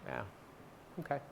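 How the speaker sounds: ordinary delivery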